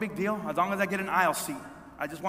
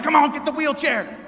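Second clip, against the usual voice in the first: raised, high re-enacted yell; impatient shout; 6 semitones higher, 5 dB louder than usual. Traveler hurrying workers taking forever, impatient shout. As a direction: holler at them to hurry.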